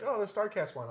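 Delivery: high, airy "oh" tossed off. Retold or imitated. Quoting own reply to autograph question.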